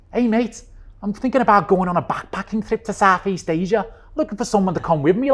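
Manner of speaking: put-on high, loud Liverpool accent; Scouse accent. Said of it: "I'll do this Scouse accent, this Liverpool accent".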